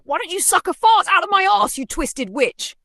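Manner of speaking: very high, loud snarling insult; furious snap; snapping insult. Acted. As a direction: spit it at her. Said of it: "I snap".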